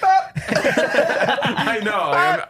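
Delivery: words breaking into laughter; can't hold back the giggles; laughing. Real, not acted. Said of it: "I'm trying not to laugh".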